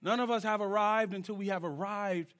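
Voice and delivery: ordinary talk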